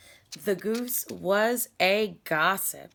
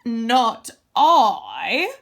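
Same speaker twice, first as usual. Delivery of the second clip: loud, brisk goose voice refusing; a lazy goose brushing off the hen; 3 semitones higher, 11 dB louder than usual. Acted.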